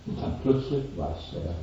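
ordinary delivery

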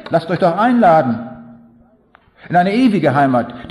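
preaching. Acted. Preacher inviting the crowd to eternal home, fervently. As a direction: call the crowd in with conviction.

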